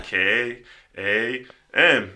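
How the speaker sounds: each letter drawn out very slow; slow spelling. Retold or imitated. Teen spelling deliberately slow for the doctor, very slow. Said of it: "I said".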